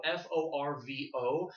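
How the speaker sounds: each letter of F O R V O said slowly and clearly; deliberate slow spelling. Shown as a demonstration.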